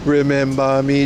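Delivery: slow, mocking fake Jamaican reggae voice; a lightly done mock Jamaican reggae lilt; mock Jamaican accent. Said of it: "you don't talk like this".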